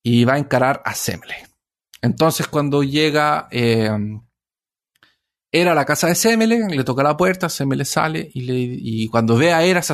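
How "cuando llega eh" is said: normal talking voice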